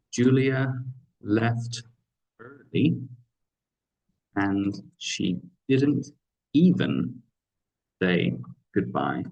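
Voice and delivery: Julia left early, and she didn't even say goodbye, with a pause before each word; slow model sentence with even lifted; slow, broken up for emphasis. Shown as a demonstration. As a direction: space the words out and lean on even. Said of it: "Going in a mid position here for even".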